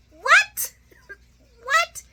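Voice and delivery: very high, loud disbelieving what; stunned Sonny. Acted. Sonny hearing Michael knew since the wedding, high mock disbelief.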